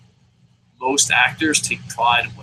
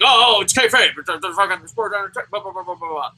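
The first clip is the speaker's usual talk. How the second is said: mocking whiny excuse; 4 semitones higher than usual. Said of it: "they're like".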